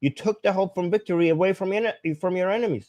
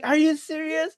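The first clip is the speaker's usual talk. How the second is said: much higher, astonished grandma voice; amazed grandma; 12 semitones higher than usual. Retold or imitated. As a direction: ask in sheer disbelief like the grandma. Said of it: "She's like".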